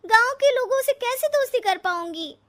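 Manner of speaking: high, loud and distressed; upset protest. Acted. Upset bride protesting to her fiancé, high and loud. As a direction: cry out the question in protest.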